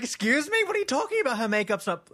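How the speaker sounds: very high-pitched, incredulous mock yell; retold yelling. Re-enacting the argument over Mariah's makeup, indignant mock yell. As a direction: yell it in outrage, mostly by pitch. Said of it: "I'm yelling at him now".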